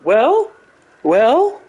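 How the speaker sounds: high, pondering professor voice; Jordan Peterson impression. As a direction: pitch up into a musing lecturer. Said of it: "He's like".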